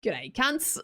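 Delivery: hammed-up Australian greeting; Australian accent. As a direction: greet them in exaggerated Aussie. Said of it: "spawned in with this accent".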